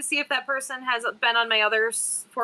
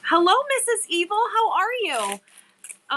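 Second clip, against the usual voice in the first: excited greeting; 7 semitones higher, 3 dB louder, slower than usual. Real, not acted. Greeting the arriving postman.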